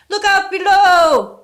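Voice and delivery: high, loud, slow bellowing warning; loud ghostly yell. A narrator's reading. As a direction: yell it out strong, no longer weak. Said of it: "yelled the voice, sounding no longer weak, but quite loud".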